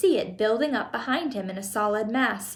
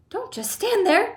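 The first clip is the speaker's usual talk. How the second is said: high-pitched furious scolding; screamed; 10 semitones higher than usual. A narrator's reading. Wife to idle husband, high screaming. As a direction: scream at him to act. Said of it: "screamed at Mister Gloop".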